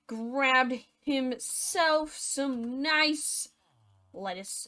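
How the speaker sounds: drawn-out and slow, savoring each word. Acted. Slowly savoring the stolen lettuce in the garden. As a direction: stretch it out like a treat.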